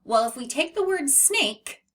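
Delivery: normal talking voice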